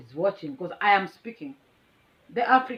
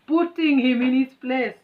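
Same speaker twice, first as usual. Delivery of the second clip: proud; 5 semitones higher, 6 dB louder than usual. Real, not acted. Proud recap of the fight.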